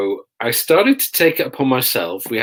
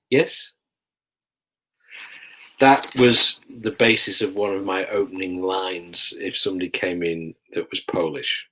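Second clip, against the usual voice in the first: ordinary talk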